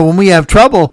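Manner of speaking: usual speaking voice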